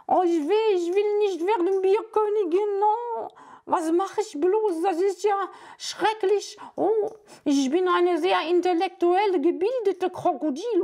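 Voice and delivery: high, foreign-sounding dopey crocodile; silly crocodile voice. Acted. Crocodile puppet fretting and then snacking, high odd voice. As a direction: play the greedy, simple crocodile with a high, odd voice.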